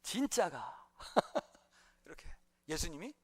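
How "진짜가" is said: amused Gyeongsang-dialect incredulity, slowing down; dumbfounded disbelief. Retold or imitated. Preacher mimics Pilate doubting Jesus is king, in comic dumbfounded disbelief. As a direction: drag out the doubt, breaking into a laugh. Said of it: "어이없는 반응을 하면서".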